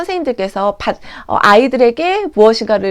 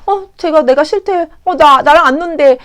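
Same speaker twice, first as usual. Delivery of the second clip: higher-pitched, acted hurt child; upset child; 5 semitones higher, 6 dB louder than usual. Child hearing a friend dislikes them, mock upset. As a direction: protest in wounded disbelief, voice lifted. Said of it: "이야기를 들은 아이는 너무 속상한 거예요".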